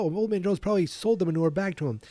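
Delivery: high quick mocking voice; character voice. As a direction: rattle it off in character. Quoting Terry.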